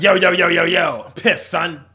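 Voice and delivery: loud, high, pumped-up street greeting; hype bro voice. Pretend. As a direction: shout it like a hyped-up homie.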